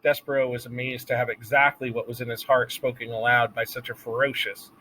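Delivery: ordinary delivery, nothing marked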